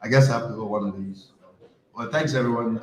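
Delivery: ordinary talk, nothing marked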